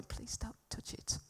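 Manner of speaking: slow, pleading whisper; meek whispered plea. Retold or imitated. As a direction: whisper it timidly, as if hoping not to be heard. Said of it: "I'm like this".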